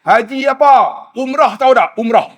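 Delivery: a retold old man's irritated retort; mock indignant retort. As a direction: snap back, insulted at being called haji. Old man angrily retorts to police.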